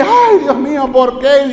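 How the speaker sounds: a theatrical fake lament; wailing "Ay, Dios mío" in mock sorrow; mock wailing mourner. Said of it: "todo el mundo anda llorando".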